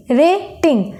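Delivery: Rating in two beats, pause after रे; split into syllables. Shown as a demonstration. Teacher counting syllables, rating broken after रे. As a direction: stop briefly between रे and टिंग in rating. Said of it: "यहाँ पर एक बार रुकते हैं".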